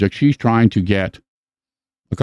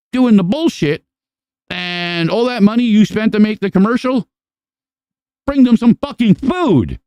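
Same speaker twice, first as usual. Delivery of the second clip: much higher, yelling comedian rant; impersonating a comic shouting at a charity film crew, much higher; Sam Kinison rant; 11 semitones higher than usual. Retold or imitated. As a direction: scream it like an outraged stand-up. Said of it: "the Sam Kinison skit was".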